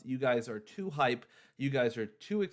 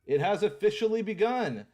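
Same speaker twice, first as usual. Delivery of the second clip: higher and louder, hyped; excited announcement; 8 semitones higher, 4 dB louder than usual. Real, not acted. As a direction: announce it with real hype. Streamer announcing the giveaway.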